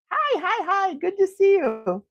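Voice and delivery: high-pitched quick hellos; delighted, high hello to a friend; real excited greeting. Real, not acted. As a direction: chirp a happy welcome up high. Greeting Brenda who joined.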